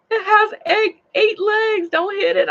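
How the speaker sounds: high, urgent mock panic; imitated alarm. Customers warning her about the spider, imitated alarm. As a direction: warn them off in a high, loud voice.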